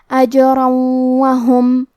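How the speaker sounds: اجرا وہم with tanween merged into nasal waw; idgham with ghunna. Shown as a demonstration. Teacher shows nasal merging to students, slow recitation. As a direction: blend into وہم with a nasal hum. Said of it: "غنہ اور تشدید کے ساتھ".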